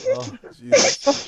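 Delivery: laughing. Real, not acted. Laughing hard after call.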